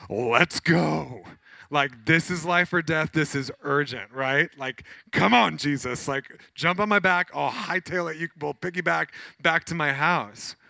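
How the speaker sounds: loud, urgent, high father begging Jesus to hurry; desperate father. Retold or imitated. Desperate dad urging Jesus home, loud and high. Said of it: "You're like".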